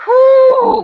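sudden high yell of pure rage; furious high whoop. Real, not acted. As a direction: let the anger burst out in one shrieking whoo. Fury boils over mid rant.

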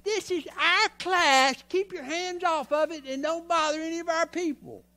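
guard your turf in a high, exaggerated voice; possessive class mock. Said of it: "we got this little thing".

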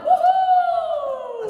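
excited whoop; cheering. Real, not acted. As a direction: whoop with joy. Cheering a win in game.

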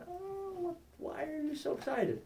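soft, high-pitched innocent question; mock innocence. Apologist to someone upset by his questions, mock innocent. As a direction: ask it high and softly, as if puzzled. Acts out calming an upset person.

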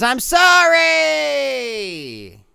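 drawn-out exclamation, sliding down at the end; hammed-up wailed apology; all-caps apology. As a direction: read it as a long, over-the-top cry of sorry. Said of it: "is all caps with four exclamation".

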